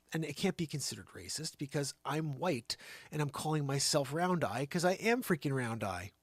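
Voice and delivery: usual speaking voice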